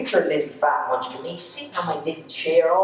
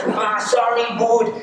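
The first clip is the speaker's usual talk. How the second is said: put-on drunk Dubliner's apology, pitched up; inner city Dublin accent; 6 semitones higher, slower than usual. Drunk Alan bumping through the pizzeria, inner city Dublin accent. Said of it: "gets a little inner city Dublin accent".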